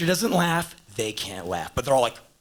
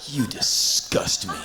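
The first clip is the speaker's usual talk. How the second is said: slow, voiceless mock contempt; disgusted crew; slower than usual. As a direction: draw out the disgust slowly. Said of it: "They're looking at me like".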